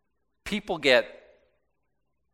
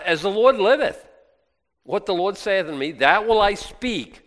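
bold proclamation; 6 semitones higher, 5 dB louder than usual. Shown as a demonstration. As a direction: ring it out, firm and louder. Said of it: "Oh, praise".

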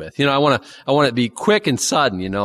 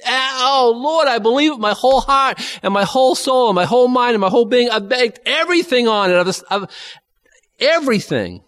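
high, loud cry of faith with the whole heart; fervent declaration; 12 semitones higher, 4 dB louder than usual. Real, not acted. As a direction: pour it out to the Lord, much higher and louder.

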